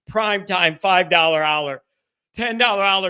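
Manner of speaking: ordinary talk, nothing marked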